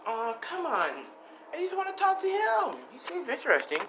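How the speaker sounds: put-on high-pitched girl protesting bedtime; pleading girl. Girl begging her minder to let her talk, pleading.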